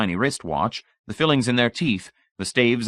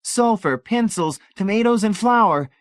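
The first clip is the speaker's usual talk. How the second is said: very high, loud, eager list; chiming in gamely; 13 semitones higher, 6 dB louder than usual. A narrator's reading. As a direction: sing out each item, getting into the swing. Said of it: "getting into the swing of it".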